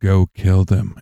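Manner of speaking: low, slow-burn murmured command, fully voiced; a low voice planting an evil idea in someone's ear; mock sinister whisperer. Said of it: "we could just whisper in his ear".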